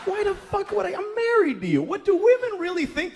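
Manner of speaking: high, loud disbelieving rant; comic ranting at the wife's notion; incredulous rant. Retold or imitated. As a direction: fire off the questions in outrage. Ranting at wife, voice pitched high.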